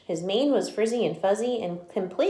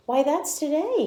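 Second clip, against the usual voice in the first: excited; 9 semitones higher, 3 dB louder than usual. Acted. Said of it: "he said".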